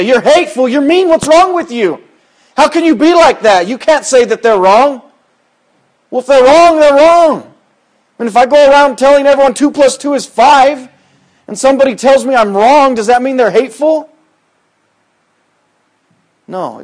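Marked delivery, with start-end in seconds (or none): 0.0-5.0 high, loud mock outrage; mock accuser. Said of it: "people would jump all over you and say". 6.1-14.1 higher and louder rebuttal; forceful preaching. Acted. Preacher answering at full force.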